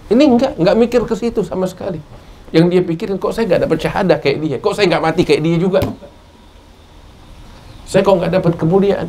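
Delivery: impassioned sermon peak, pitched high; fervent preaching. Acted. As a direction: drive the point home with rising fervor.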